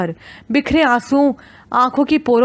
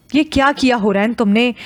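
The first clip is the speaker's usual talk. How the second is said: ordinary delivery, nothing marked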